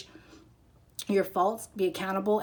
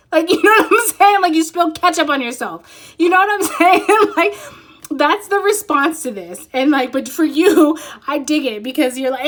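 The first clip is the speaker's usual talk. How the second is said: laughing; 9 semitones higher, 11 dB louder than usual. Real, not acted. Laughing at her own joke.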